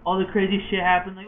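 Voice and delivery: normal talking voice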